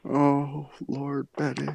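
overdone soft mourning for Betty; hammed-up mourning. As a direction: sigh out a theatrical grief.

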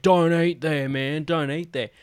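mock blurted warning, pitched a bit up; imitated blurting. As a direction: blurt it out like the pub know-it-all. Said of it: "he's just blurting out".

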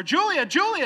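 put-on loud, high-pitched calls for a missing child; frantic shouting. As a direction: shout her name over and over. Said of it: "And he's screaming for her".

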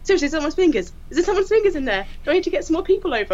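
high, rushed urgent questions; alarmed questions. Retold or imitated. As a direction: press for answers in alarm. PCSA alarmed by fingers.

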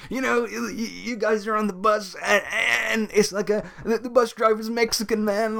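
high, mocking dude voice. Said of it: "he kept saying things like".

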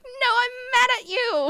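high, loud tearful wail; hammed-up furious crying; mock crying rage. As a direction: wail angrily through pretend tears. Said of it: "I'm crying harder".

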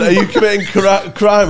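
hammed-up bobby, barely holding the laugh; policeman.

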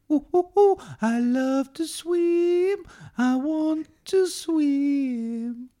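very high, slow, squealing; high, slow, excited cartoon fish; Dory voice. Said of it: "[name]'s movie impression".

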